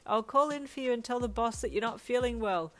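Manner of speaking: high, carrying call. A narrator's reading. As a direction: project it across the house. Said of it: "Hawks called from the kitchen".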